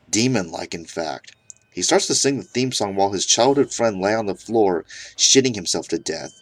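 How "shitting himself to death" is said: usual speaking voice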